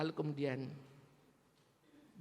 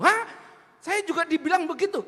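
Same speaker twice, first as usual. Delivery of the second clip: louder, higher shocked reply; surprised outburst; 6 semitones higher, 9 dB louder than usual. Retold or imitated. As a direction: react like you just heard the same rumor. Musa surprised at shared gossip. (kaget dan suara lebih keras)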